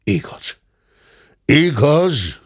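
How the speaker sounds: grudging agreement; grumbled admission. Acted. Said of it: "dörmögte a ténsúr".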